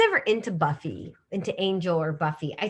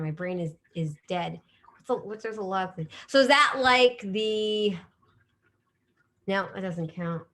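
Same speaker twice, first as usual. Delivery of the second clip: ordinary talk, nothing marked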